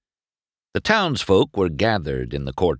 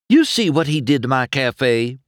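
high, louder voice of protest; indignant demand; 8 semitones higher, 5 dB louder than usual. A narrator's reading. Indignant show car complaining about her cafe, demanding. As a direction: demand it, affronted and raised. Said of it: "Flo demanded".

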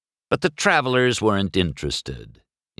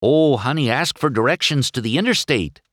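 higher-pitched wifely plea; begging; 7 semitones higher, 3 dB louder than usual. A narrator's reading. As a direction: plead with your husband. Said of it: "Missus Minivan begged".

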